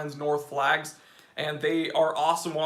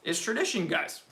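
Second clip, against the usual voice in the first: usual speaking voice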